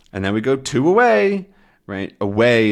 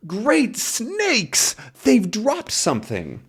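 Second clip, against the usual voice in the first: high-pitched storybook amazement; astonished exclamation; 12 semitones higher, much slower than usual. Acted. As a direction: exclaim high in acted shock. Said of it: "just what Tintin says as, oh my word".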